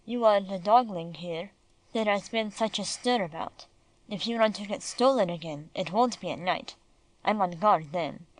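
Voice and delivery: a hint of a stern German guard dog; deep German accent. German police dog warning the little dog, lightly German-accented and deep. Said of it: "in a deep foreign voice".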